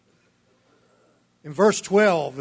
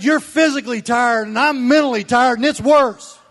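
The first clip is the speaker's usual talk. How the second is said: high, loud, emphatic wife's retort; quoting the wife, much higher and louder; 8 semitones higher, 9 dB louder than usual. Retold or imitated. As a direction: fire back her point, pitched up and loud. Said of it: "She said".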